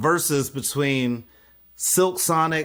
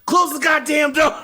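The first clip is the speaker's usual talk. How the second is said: much higher, loud exasperated shout; fed-up yell; 13 semitones higher, 6 dB louder than usual. Retold or imitated. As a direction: blow up at the door. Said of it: "That was Mitch".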